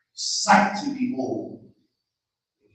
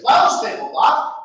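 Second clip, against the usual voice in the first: command; 9 dB louder than usual. Acted. Magic command to tablecloth.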